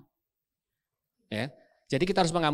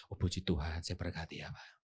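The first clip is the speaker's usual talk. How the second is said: saintly forgiveness; 9 semitones lower, 11 dB quieter than usual. Retold or imitated. Pastor blesses again.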